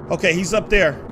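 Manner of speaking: ordinary talk, nothing marked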